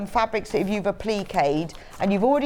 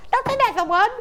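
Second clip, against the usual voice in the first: high, loud mock whine; mock exasperation; 13 semitones higher, 4 dB louder than usual. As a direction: whine it like a put-upon kid. Mock reluctant reply.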